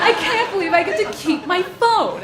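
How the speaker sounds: giddy relief. Retold or imitated. To the gunman, fast giddy gushing relief. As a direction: gush like he just did you a favor. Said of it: "immediate Stockholm Syndrome".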